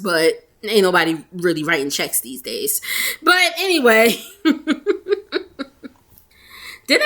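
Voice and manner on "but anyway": laughing. Real, not acted. Laughs after cashier check slip.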